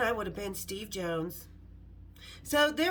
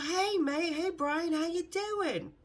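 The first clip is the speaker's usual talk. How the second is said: friend on the phone; 6 semitones higher, slower than usual. Retold or imitated. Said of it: "He's like".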